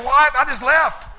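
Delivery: sharp, high, loud what; surprised. Retold or imitated. Pastor hearing Tom already called Jeff, loud surprise.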